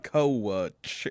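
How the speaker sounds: "coach" drawled as koh-uch; Southern two-syllable coach. Shown as a demonstration. Grandson shows the drawled "coach" again. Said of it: "two syllables".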